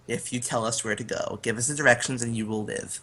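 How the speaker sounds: low, softened threat; menacing bargain. Acted. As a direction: quietly lay down terms to a captive. Player bargains with captive in character.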